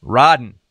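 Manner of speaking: "riding" said loud as ridin'; "riding" ending in a plain N, Southern style; dropped G. Shown as a demonstration. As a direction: drop the final G in "riding". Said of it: "didn't even put G's on them".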